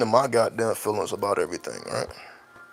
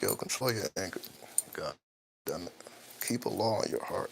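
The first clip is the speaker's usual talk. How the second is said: softly imitating son; 5 semitones lower, 15 dB quieter than usual. Dad voices son's advice.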